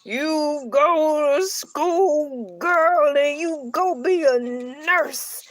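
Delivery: put-on slow, drawn-out old-timer lecture; old fogey. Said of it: "The old fogies ... talk about some".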